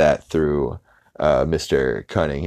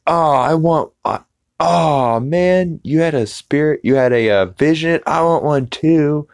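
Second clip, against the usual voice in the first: high, eager elder voice full of envy; 10 semitones higher, 3 dB louder than usual. Acted. Casino chief envies Peter's vision, high and eager. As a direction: pitch it up and gush with longing.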